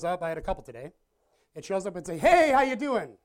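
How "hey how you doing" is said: louder and higher, a chirpy salesman greeting; cheery mock greeting. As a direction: play the stress as a friendly pest. Stress personified greets cheerfully.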